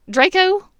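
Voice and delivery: loud, high disbelieving cry; shocked, calling out the name; incredulous. Acted. Bellatrix startled by her sister's answer, incredulous.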